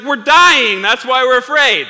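loud, high exasperated shout; panicked protest. Acted. Disciples woken Jesus in a storm, panicked protest. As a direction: yell it back in acted panic.

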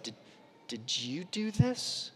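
slow, hushed, restrained anger; ticked-off dad. Retold or imitated. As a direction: drag out the question softly, holding back irritation. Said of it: "a dad who's just been ticked".

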